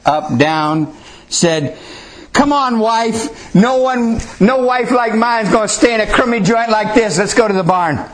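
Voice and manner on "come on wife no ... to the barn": high-pitched, hammed-up husband refusing the room; mock-offended stage husband, pitched up high; indignant boy Joseph. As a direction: play a kid actor grandly scorning the inn. Boy Joseph indignantly improvises rejection of inn.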